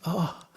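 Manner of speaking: high acted cry of pain; storyteller's mock moan; pained wail. A narrator's reading. Injured king moans in pain.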